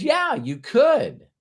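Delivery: very high, drawn-out concession; mock concession. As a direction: stretch it out high, conceding with a but coming. Mentor's retold doubtful concession.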